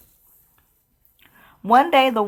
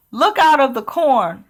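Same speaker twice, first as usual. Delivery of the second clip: yelling warning; 6 semitones higher, 3 dB louder than usual. Acted. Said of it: "Elizabeth yelled".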